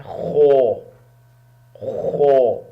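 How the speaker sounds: "خو" loud, thick and throaty from the back; bold kho. Shown as a demonstration. Teacher shows students the heavy letter, bold throaty demo. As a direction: rasp out "خو" full and heavy. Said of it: "bold ہے، موٹی آواز ہے اس کی".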